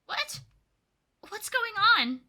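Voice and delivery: high, loud panic; shocked gasp of a question; voicing Squirtle seeing itself as a Pokemon; startled. Panicked at reflection.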